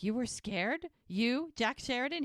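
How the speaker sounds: retold disbelief, building on YOU; incredulous. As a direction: mimic someone amazed by a confession. Retold Ricky disbelieving Jack was scared.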